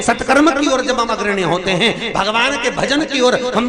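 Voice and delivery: preacher in full flow; fiery preaching. Acted. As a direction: rouse the crowd with each line.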